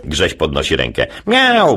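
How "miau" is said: high meowing cat. Acted. Grześ answering the teacher, high cat meow. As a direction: meow like a kitten.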